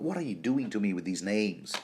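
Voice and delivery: ordinary talk, nothing marked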